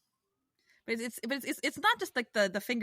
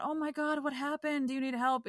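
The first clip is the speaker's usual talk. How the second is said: higher, gushing mock concern; mock sweet concern; 6 semitones higher than usual. Contrasting a nice helper, gushing mock concern. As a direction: coo it like an overly nice helper. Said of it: "their way of helping you is not".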